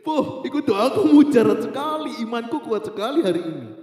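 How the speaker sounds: loud, high, hammed-up exultation; amazed triumph. Teenager marvelling that the prayer worked, hammed-up triumph. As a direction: crow in mock amazement, stretching it out.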